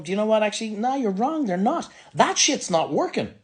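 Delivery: tossing off a blunt rebuttal; mock fed-up onlookers. Onlookers answering the labels back, with an impatient retort. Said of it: "turning around going".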